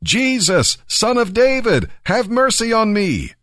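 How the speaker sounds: high, pleading call pitched well up; shouting for mercy. A narrator's reading. Blind beggar calls out to passing Jesus, high pleading cry. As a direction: cry out to him over the crowd. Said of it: "So he began shouting".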